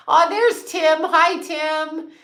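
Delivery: high, bright hello to a newcomer; light up at a familiar face; cheery welcome; delighted greeting. Real, not acted. Greeting a viewer joining.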